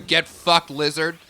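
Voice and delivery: loud gamer jab at the lizard; yelling at enemy. Real, not acted. While fighting a lizard in the game, loud taunt. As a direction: bark it at the screen.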